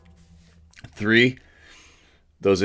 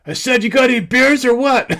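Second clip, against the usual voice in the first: much higher, loud put-on yell, breaking into a laugh; louder pushy prank call to the neighbor; disguised shout; 9 dB louder than usual. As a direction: holler again, hiding your real voice and cracking up. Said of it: "Without finding my voice".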